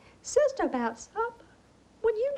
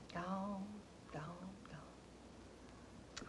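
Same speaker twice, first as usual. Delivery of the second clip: fading whisper; 4 semitones lower, 18 dB quieter, much slower than usual. Acted. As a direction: whisper it away to nothing. Lament for lost servants.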